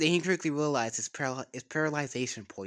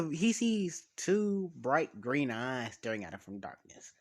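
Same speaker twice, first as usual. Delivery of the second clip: ordinary delivery, nothing marked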